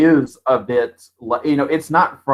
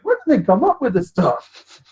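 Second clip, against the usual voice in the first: mildly irritated retold grumble, pitched up; pissed off; 8 semitones higher than usual. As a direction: act out an exasperated complaint, higher. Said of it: "getting pissed off".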